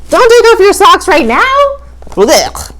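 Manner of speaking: loud, high, grossed-out cry; mock disgust. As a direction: yell it in playful disgust. Teasing Hans about smelly feet.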